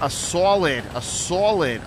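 hammed-up Boston accent on "solid"; ridiculous Boston accent, "SAW-lid". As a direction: say "solid" with a broad, rounded Boston vowel. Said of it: "Throw that ridiculous Boston accent in there".

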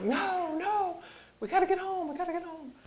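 very high, strained urging; high-pitched imitation of a frantic husband; retold urgent insistence. Husband urging during retold panic.

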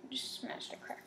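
soft unvoiced aside; whispering. Real, not acted. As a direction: whisper it under your breath. Aside while setting up challenge.

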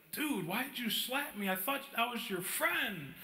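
hurt protest. Retold or imitated. Slapped friend asking why he was hit, hurt protest. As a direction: protest in mock-hurt disbelief.

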